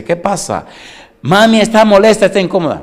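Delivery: high, loud worried little voice; baby voice. Pretend. Unborn baby told that mommy is upset, high worried voice. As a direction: play the unborn baby getting the news.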